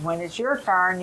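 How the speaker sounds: ordinary talk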